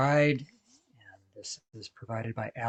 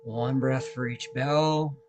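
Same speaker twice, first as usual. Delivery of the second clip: guided meditation. Acted. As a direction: guide listeners gently through each breath, unhurried. Leads a guided breathing meditation.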